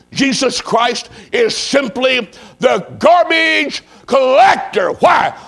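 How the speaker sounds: slow build to a high, loud peak; preaching cry. Real, not acted. Preacher confronting the congregation about their lives, loud impassioned cry. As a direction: thunder it out, stretching the key words.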